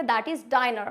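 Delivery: diner with a long eye sound; teacher saying diner with long i; model word diner. Shown as a demonstration. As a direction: model diner as die-ner. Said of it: "तो उसको क्या पढ़ेंगे".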